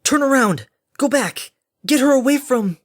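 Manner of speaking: loud, high shouted warning; urgent snarl. A narrator's reading. Harmony warns Derpy off the blast, snarling. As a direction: bark frantic orders, cut off at the end. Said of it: "Harmony snarled against the beating wind".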